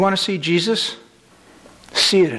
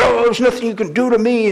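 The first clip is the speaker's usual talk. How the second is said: higher-pitched mock bravado; cocky retelling; 6 semitones higher, 3 dB louder than usual. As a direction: play an arrogant middle schooler, taunting. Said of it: "He was very arrogant".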